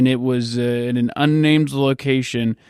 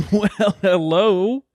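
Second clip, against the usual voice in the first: drawn-out, higher mock hello; a slow rising hello, hammed up; mock sultry hello; 7 semitones higher, slower than usual. Friend greets his own penis in a silly higher hello.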